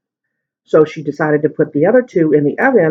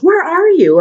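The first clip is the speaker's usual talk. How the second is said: yelling mom on the phone; 13 semitones higher, 4 dB louder than usual. Retold or imitated. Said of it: "she's yelling".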